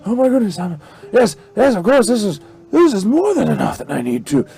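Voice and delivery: high, stammering, overwhelmed farmer; grateful farmer. Acted. Farmer finds gold in the pouch, overwhelmed and grateful. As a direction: stammer thanks in a high-pitched voice.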